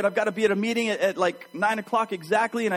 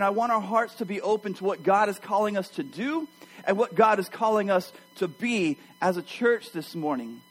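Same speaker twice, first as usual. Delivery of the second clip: ordinary talk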